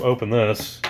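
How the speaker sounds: usual speaking voice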